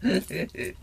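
whispering in wonder; awed whisper. Real, not acted. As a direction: breathe it reverently. Whispering over gifts.

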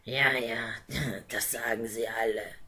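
whispered mocking laugh; scoffing dragon. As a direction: dismiss her with a hushed chuckle.

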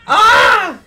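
high, loud shriek; startled scream. Real, not acted. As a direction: scream out in sudden shock. Reacting to painful clip.

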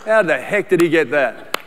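low, muttered resentful aside; mock a jealous pastor; jealous grumble. Pastor voices a leader envious of others' success, low grumble. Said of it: "look at them and go".

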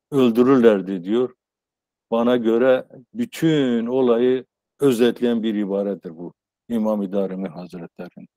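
ordinary talk

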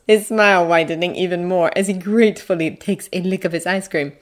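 ordinary delivery, nothing marked

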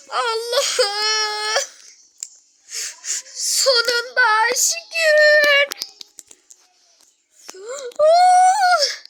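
tearful high shouts of relief; overwhelmed with happy tears; sobbing, laughing joy; joyful crying. Real, not acted. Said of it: "Cırır cırır bağırıyorsunuz".

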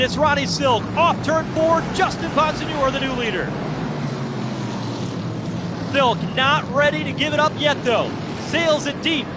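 ordinary talk, nothing marked

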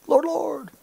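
high-pitched imitation of a churchgoer; put-on pious cry; mock piety. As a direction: call it high, without much volume. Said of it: "they played a game".

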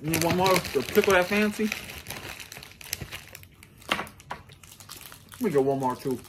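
speaking between bites; talking while chewing. Real, not acted. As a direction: mouth partly full of candy. Seen 0: Man ate candy just before; lips pursed, jaw working while speaking.

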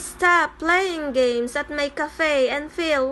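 ordinary talk

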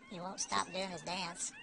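normal talking voice

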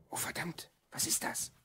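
hushed, alarmed whisper; frightened whisper. Acted. Ron hiding in Mark's room, afraid of discovery, whispering. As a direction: whisper so nobody hears. Hiding at night, someone coming.

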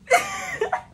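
squealing laugh at her own story; high burst of laughter. Real, not acted. Vlogger laughing at her own cheeseburger habit, high squeal.

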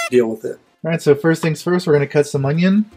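normal talking voice

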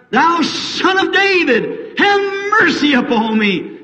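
high, slow drawn-out plea; crying out. Retold or imitated. Blind Bartimaeus cries to the Son of David, high and slow. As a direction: stretch the call out so he hears. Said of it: "And he cried".

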